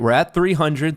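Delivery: usual speaking voice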